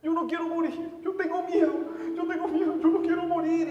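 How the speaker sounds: loud, high, sobbing cry of panic; crying in terror. Retold or imitated. Dying Gonzalo begs his sister, screaming in terror. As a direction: wail it like a child terrified of dying. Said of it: "llorar como un niño de ocho".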